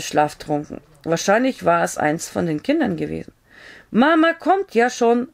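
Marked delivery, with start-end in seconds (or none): 3.9-5.3 calling out. A narrator's reading. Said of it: "rief sie".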